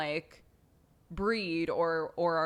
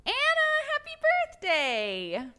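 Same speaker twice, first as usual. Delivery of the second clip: high, bright birthday greeting; sing-song birthday wish; 4 dB louder than usual. Real, not acted. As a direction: squeal a happy birthday.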